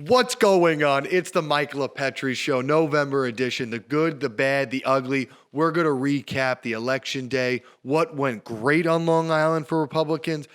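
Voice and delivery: loud, high-energy radio host intro; show opener. Acted. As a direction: kick off the show with punch.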